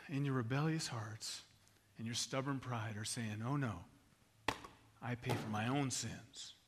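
low, soft altar appeal with long pauses; hushed, measured sermon delivery; solemn preaching. Real, not acted. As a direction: bring it down to a grave, tender plea. Preacher's hushed appeal after the story.

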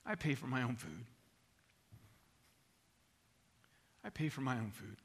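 low, subdued, resolute customer; acted grave insistence; quiet refusal. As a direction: say it softly and firmly, with a long pause between. Acted customer refusing, pushing gift away.

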